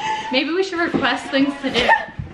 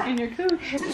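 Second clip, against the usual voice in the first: usual speaking voice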